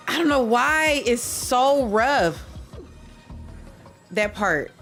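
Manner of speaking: ordinary talk, nothing marked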